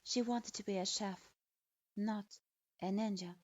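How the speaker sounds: plain reading voice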